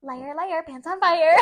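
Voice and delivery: loud singsong chant; mock taunt. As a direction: shout it like a playground kid.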